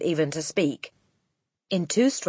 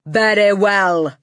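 booming, drawn-out and higher; Trunchbull; 5 semitones higher, 10 dB louder, slower than usual. A narrator's reading. Headmistress opening the test, slow loud boom. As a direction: boom it slowly across the classroom. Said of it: "boomed the Trunchbull".

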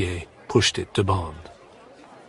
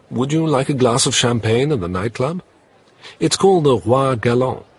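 Bond inviting; 5 semitones higher, 5 dB louder than usual. Acted. Bond invites Vesper to the nightclub.

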